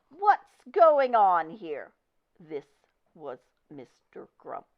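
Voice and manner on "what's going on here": loud, slow, high furious demand; furious shout. Acted. Store manager shouting in fury at the scene. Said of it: "charged with fury, shout".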